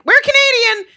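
high, loud, hurried insistence; urgent protest. Retold or imitated. Tourist assuring a hostile Greek cab driver, urgent high protest. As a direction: blurt it out in a high mock yell. Frightened Americans insist they are Canadian.

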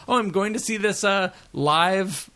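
gushing past self, hammed up; naive excited self. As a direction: play your past self all starry-eyed. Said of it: "I kept saying to people".

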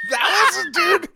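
high, loud laughing protest; real cracking-up outburst; laughing shout. Real, not acted. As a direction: yell through laughter at a friend.

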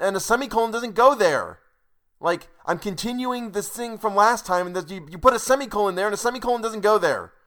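loud, high-pitched scolding over a comma; exasperated yelling. Real, not acted. Reader scolding the author Bill, loud angry rant. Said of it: "screaming about semicolons".

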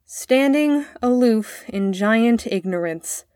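slow, careful recitation; boy reciting poem. A narrator's reading. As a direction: begin a learned verse dutifully, word by word. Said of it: "The boy began".

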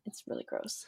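breathy whispered disgust; whispered gross. Real, not acted. Whispering disgust about ticks to her friend.

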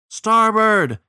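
high, loud echoing call; shouted answer. A narrator's reading. Crew repeating the captain's order, shouted. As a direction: holler the order back. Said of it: "answered the crew".

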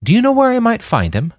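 put-on earnest girl looking for someone; Sasha asking. A narrator's reading. Sasha asks the guard.

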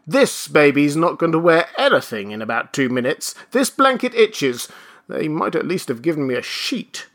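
high, querulous grumbling; grumble, pitch jumping up in complaint; peevish old man. Acted. Old man threatens to shed blanket, peevish.

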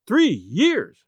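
high, swooping exclamation; astonished cry. A narrator's reading. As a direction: cry it out in amazement. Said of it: "I cried".

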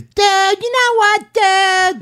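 very high caricature voice; imitated impersonation. Comedian shows how his friend mimics him, very high and exaggerated. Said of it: "His impression of me is".

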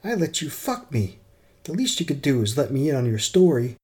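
high angry outburst; furious. Acted. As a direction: throw it at him in fury. Furious intern storms out.

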